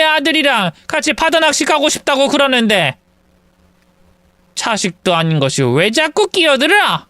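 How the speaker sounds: mock scornful mother-in-law; snapping mother-in-law. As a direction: put the meddling daughter-in-law in her place. Mother-in-law snaps at daughter-in-law.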